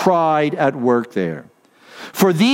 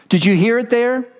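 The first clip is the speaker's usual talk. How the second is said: ordinary delivery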